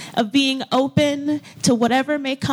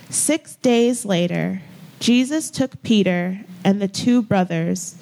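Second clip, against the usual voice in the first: slow, measured reading in a lowered register; deliberate phrases with space between them; slow scripture reading; 4 semitones lower than usual. Real, not acted. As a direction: read sacred text aloud for listeners to take in. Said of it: "I will read the text slowly".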